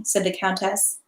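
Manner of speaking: ordinary delivery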